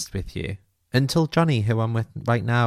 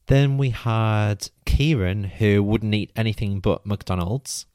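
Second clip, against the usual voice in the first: ordinary delivery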